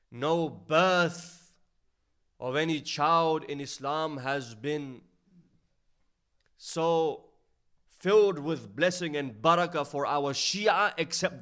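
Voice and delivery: slow weighty pulpit emphasis; emphatic preaching. Acted. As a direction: drive the hadith home slowly. Stressing the hadith's blessing.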